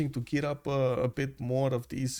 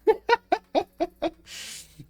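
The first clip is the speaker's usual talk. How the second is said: high giggle; 4 dB louder than usual. Real, not acted. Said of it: "Oh my God".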